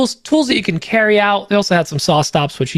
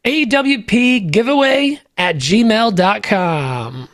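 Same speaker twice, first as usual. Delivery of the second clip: A W P giveaway at gmail dot com spelled out slowly, each part spaced; slow and clear; 2 semitones higher, much slower than usual. Shown as a demonstration. Host repeats address slowly for listeners at back, carefully spaced. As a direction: read the email address slowly for the people in the back. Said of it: "Say that again for the people in the back".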